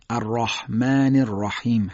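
slow "الرحمن الرحیم" with thick, dark r; deliberate tafkhim recitation; heavy ra. Shown as a demonstration. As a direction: recite "الرحمن الرحیم" with full-mouthed "ra" and long vowels.

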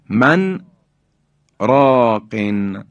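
من راقن split by a deliberate pause; sakt pause. Shown as a demonstration. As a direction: halt after the n of من, then draw out راقن. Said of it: "بایستی یک سکتی".

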